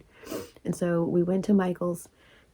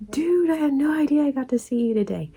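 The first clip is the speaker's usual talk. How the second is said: high-pitched excited exclamation; excited retelling; 7 semitones higher than usual. Retold or imitated. Texting her best friend about the surprise visit, excited. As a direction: gush it, pitched well up.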